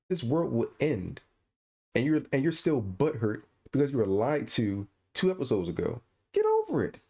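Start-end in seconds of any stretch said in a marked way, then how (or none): 6.3-7.0 high exasperated cry; exasperated outburst. Real, not acted. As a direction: fling it out in disbelief. Exasperated rant at Teela.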